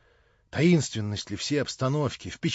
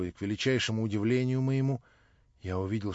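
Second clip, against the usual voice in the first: plain reading voice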